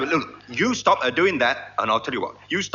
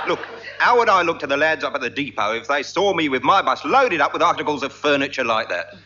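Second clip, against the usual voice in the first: ordinary talk, nothing marked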